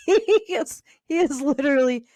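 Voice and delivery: high, real laugh breaking into the words. Real, not acted. Storyteller cracks up recalling the same clothes, laughing. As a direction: crack up mid-sentence.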